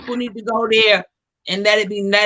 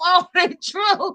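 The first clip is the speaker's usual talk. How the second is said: laughing; 6 semitones higher, slower than usual. Real, not acted. Cracking up after a joke.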